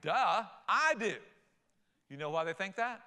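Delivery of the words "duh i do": slow, louder, sarcastic "duh"; mocking "duh". Average person scoffing at a pastor's question, slow and louder. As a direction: drag it out like the question is stupid.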